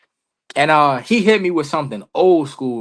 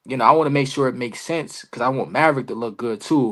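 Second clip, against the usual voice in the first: normal talking voice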